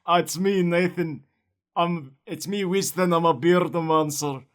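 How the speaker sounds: lightly voiced gruff dwarf, chewy vowels; a hint of a dwarf talking through caramel; Gimli caramel accent. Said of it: "you sound like, um, like Gimli if he ate, like, a bunch of caramel".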